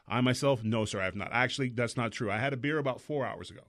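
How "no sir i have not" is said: low, soft, proper voice; polite police voice. Retold or imitated. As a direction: sound like a model citizen. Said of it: "a great voice for talking to the police".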